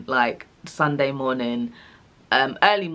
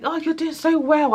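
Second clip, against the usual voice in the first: much higher, sugary encouragement; sing-song midwife; 12 semitones higher than usual. Retold or imitated. Midwives cheer on the woman in labour, much higher.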